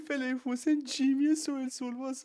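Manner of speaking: high, loud, laughing through the words; mocking the quote mid-laugh. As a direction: crack up while quoting it. S1 laughs repeating the line.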